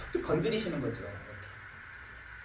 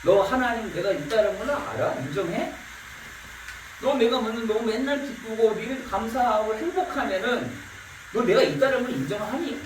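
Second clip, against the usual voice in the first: higher, louder challenging voice of God; 6 semitones higher, 4 dB louder than usual. Acted. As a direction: press them like God asking. Preacher voices God challenging people.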